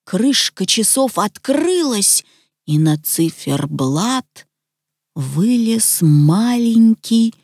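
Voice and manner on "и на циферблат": ordinary delivery, nothing marked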